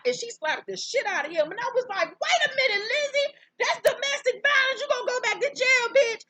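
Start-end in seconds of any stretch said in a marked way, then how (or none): 2.1-6.2 shocked shriek. Retold or imitated. Shocked reaction to a slap.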